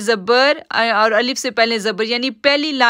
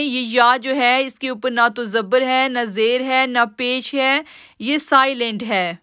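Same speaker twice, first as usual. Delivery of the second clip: normal talking voice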